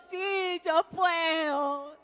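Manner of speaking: soft, slow, reluctant yes; reluctant tearful child. Retold or imitated. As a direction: give in just to please mom. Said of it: "y yo". (un sí lento y resignado de niña)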